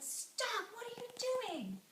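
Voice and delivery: high cry to halt; alarmed protest. Acted. Townspeople stopping boy and father, alarmed. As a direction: call out in disbelief, pitched high.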